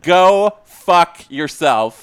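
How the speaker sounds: playful shouted anger, half laughing; hammed-up fury at a troll; shouting in jokey outrage; mock outrage.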